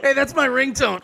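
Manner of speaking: high, shouted joking call; mock shout. Joking about a phone ringing in hiding, shouted. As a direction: yell it out as the punchline.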